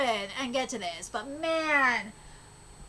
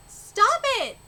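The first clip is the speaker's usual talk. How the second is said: desperate shout; 8 semitones higher, 8 dB louder than usual. Acted. Character pleads in a fight.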